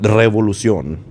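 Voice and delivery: "Revolucion" with a trilled R off the tongue tip; Spanish word "Revolucion", R trilled; Spanish rolled R. Shown as a demonstration. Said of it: "That's how you say it".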